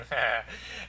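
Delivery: quiet, low chuckle under the breath; soft low chuckle. Real, not acted. Amused at his own cracking voice, soft chuckle. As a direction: chuckle softly to himself.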